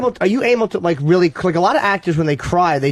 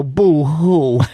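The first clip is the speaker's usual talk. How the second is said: fake crying. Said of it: "and I go".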